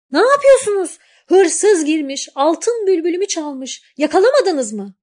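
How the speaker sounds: high, loud scolding yell; angry shout. A narrator's reading. Girl scolds horse and lion, shouting high and loud. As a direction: berate the lazy guards. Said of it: "diye bağırmış genç kız".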